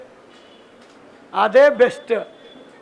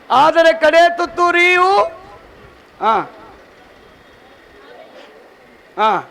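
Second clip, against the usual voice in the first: loud, high proclamation, slowing on the last words; thundering the apostle's words to the congregation; preaching scripture; 4 semitones higher, 5 dB louder than usual. Real, not acted. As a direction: call out the verse like a herald. Reading verse in preaching voice.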